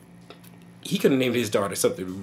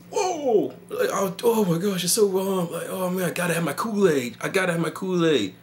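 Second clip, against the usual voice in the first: high-pitched hammed-up raving; exaggerated high swoon of mock excitement; mock craving; 7 semitones higher than usual. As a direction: gush like a desperate fan. Mocks craving for Kool-Aid.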